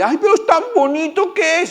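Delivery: high doting mother voice, teasing; doting mother imitation. Preacher mocking proud parents, high doting voice. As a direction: mimic a mother gushing over her baby.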